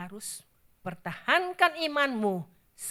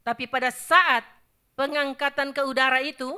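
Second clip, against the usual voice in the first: higher, much louder proclamation; proclaiming loudly; 5 semitones higher, 10 dB louder than usual. Real, not acted. Preacher announcing the rapture to the congregation, loud. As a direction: cry it out to the hall.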